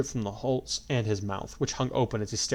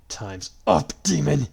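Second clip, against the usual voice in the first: furious challenge; 5 semitones higher, 7 dB louder, slower than usual. A narrator's reading. Kirito confronts Grima.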